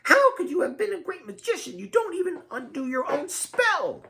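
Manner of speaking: high, piercing tirade; shrill, exasperated character shriek; Lydia screeching. A narrator's reading. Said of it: "Lydia screeches".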